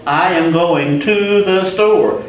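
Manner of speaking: slow, careful model sentence; slow example sentence. Shown as a demonstration. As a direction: spell out the example slowly for students. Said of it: "For example".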